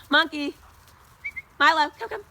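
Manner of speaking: loud high call across the yard. Real, not acted. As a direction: shout for the dog to come. Calling distant dog.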